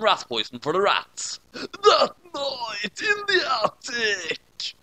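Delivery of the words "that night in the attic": high, slow, dramatic wail; spooky announcer. Acted. Narrator setting the spooky attic scene, high, slow and wailing. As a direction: wail it like a horror trailer.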